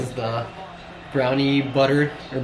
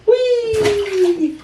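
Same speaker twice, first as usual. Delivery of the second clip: very high, gleeful whee; 6 dB louder than usual. Real, not acted. Father playing with baby, very high gleeful whee. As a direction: squeal it like a slide ride.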